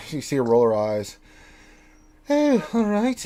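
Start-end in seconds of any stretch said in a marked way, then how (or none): 2.3-3.2 higher, resigned daughter voice; raised-pitch character acceptance; gnomish daughter. Acted. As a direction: give in after an eye-roll. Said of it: "she's like".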